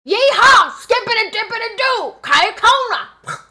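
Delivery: loud, high yeehaw holler; hammed-up hillbilly whooping, drawn out at the end. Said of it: "this is not a trailer park".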